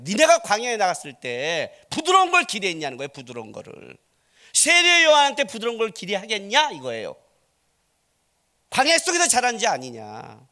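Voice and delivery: loud, rising volley of challenging questions; full-force preaching. Real, not acted. Preacher challenging the congregation on what they expected of John, full-force preaching. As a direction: preach it hard, building to high shouted questions.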